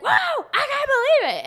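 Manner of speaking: hammed-up heel commentator gasp; the King's shock. Commentator reacting to the heel, hammed-up shocked voice. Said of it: "he's like, he's like".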